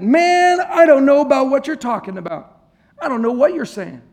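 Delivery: loud, high, exasperated outburst; exasperated loud denial. Acted. Peter fed up with the bystander, loud and exasperated. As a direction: shove the question away, fed up.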